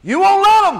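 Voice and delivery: high, loud, forceful cry; preaching shout. Real, not acted. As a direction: hammer it home to the congregation. Preacher hammers the repeated answer.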